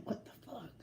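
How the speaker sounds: unvoiced whisper of disbelief; whispered shock. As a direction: hiss it under your breath. Shocked at FaceTime call.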